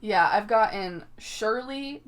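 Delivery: ordinary talk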